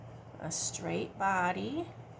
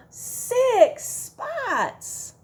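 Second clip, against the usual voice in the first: mock amazement; 13 semitones higher, 6 dB louder than usual. Teacher pretending to be amazed at ladybug spots. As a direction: gasp then a high six spots.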